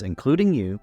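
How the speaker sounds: normal talking voice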